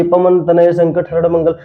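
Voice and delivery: quick recital with no pause; wrong reading of the doha, no break at the pause; rushed reading. Shown as a demonstration. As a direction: rattle it off without stopping. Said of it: "कैसे पढ़ दिए".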